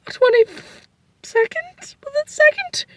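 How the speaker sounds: dazed high questioning. Acted. Dazed wife questions date.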